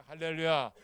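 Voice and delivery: ordinary delivery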